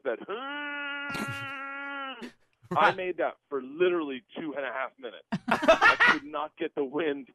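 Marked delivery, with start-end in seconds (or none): 0.2-2.3 high strangled gasp for air, imitating being winded; mock the breathless wheeze of a knocked-out man; wheezing winded noise. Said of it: "you know how that you make that noise".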